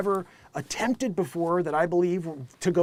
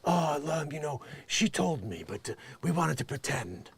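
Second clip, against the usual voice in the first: mumbling Alec; 5 semitones lower, 7 dB quieter than usual. Retold or imitated. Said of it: "he's like".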